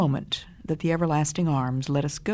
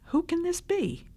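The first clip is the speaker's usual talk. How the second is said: much higher, awed question; fearful astonishment; 14 semitones higher than usual. A narrator's reading. Disciples to one another after the storm, high awed question. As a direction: ask it in awe and fear. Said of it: "In fear and astonishment".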